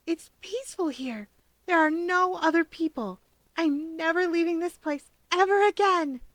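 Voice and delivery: put-on a dreamy, happy little boy; child describing his perfect hideaway; content child. A narrator's reading. Child loves quiet vault.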